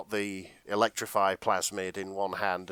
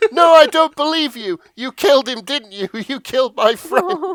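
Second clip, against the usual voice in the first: loud, much higher grief-stricken protest; distraught yelling; 13 dB louder than usual. Acted. Jack yells at Fontaine over his dead friend. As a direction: cry out at the betrayal.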